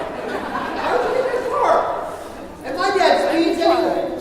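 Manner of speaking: high, loud, laughing disbelief; can't believe anyone would; laughing why would you; incredulous laugh. Real, not acted. Incredulous question to audience.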